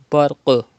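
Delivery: Barq with loud rebounding qaf; bouncing qaf demo. Shown as a demonstration. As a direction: pop the qaf so it echoes. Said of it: "like that".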